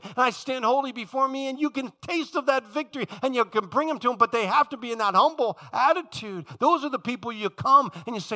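high, driving, impassioned preacher; full-force preaching. Real, not acted. Preacher urging compassion for people burdened by guilt, full-force preaching.

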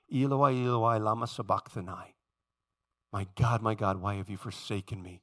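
low, soft and broken; hushed anguished cry. Acted. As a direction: let the cry fall to near silence.

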